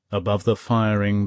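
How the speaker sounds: ordinary delivery, nothing marked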